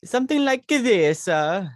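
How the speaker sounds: high, loud mock-Italian voice; over-the-top Italian movie accent; Italian accent impression. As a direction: ham up a big Italian accent. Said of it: "it would probably sound something like this".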